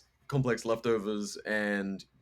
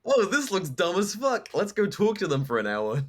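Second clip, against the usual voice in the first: mocking ourselves; 7 semitones higher, 3 dB louder than usual. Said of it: "We're gonna be like".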